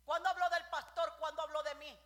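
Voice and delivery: loud, high rebuking cry; fiery pulpit rebuke; preaching shout. Real, not acted. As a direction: hammer each question at the pews. Preacher rebukes gossiping congregation.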